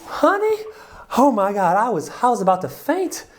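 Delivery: put-on high, loud gushing Southern woman, Southern accent. Retelling the divorced woman's story, in a giddy Southern accent. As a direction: swoon in a Southern accent, breathless with delight. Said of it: "I need to work on my Southern accent".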